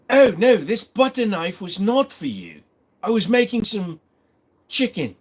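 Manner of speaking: hammed-up British protest; mock British accent. Streamer plays a Brit explaining a butter knife, mock British accent. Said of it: "as a Brit, I can't even say an insult back".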